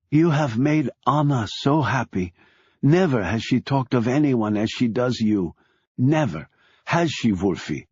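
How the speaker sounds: ordinary delivery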